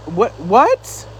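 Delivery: usual speaking voice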